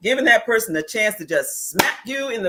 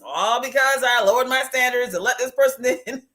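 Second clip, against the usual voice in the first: mock lament; 6 semitones higher than usual. Mock lament over own past mistake.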